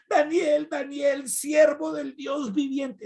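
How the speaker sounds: king calling out. Retold or imitated. King calling Daniel into the lions' pit, high anxious call. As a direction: call into the pit full of anxiety.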